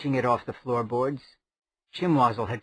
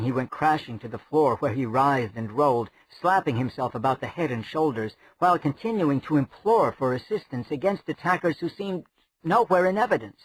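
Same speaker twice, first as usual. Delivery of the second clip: usual speaking voice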